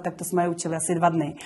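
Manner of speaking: ordinary talk, nothing marked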